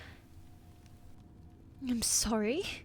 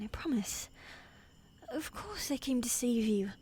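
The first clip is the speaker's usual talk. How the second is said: usual speaking voice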